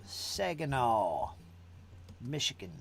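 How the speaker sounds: put-on Southern twang, long last vowels; southern accent. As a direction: stretch the place names in a playful Southern accent. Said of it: "it's got a southern accent".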